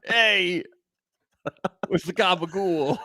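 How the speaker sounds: wiseguy demanding his gabagool; a touch of Sopranos mobster; New Jersey mobster accent. Pretend. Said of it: "Tony Sopranos".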